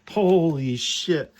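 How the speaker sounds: loud, slow amazed exclamation; excited shout. Real, not acted. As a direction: stretch it out, thrilled. Cards fit perfectly.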